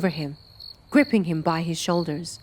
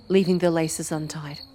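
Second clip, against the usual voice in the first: usual speaking voice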